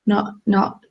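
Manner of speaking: ordinary talk, nothing marked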